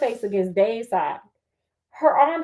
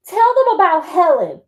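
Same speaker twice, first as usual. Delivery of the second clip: angry demand; 5 semitones higher, 6 dB louder than usual. Acted. Confronts Heather angrily.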